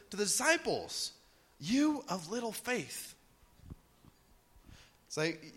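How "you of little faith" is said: gentle rebuke. Retold or imitated. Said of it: "what was his response".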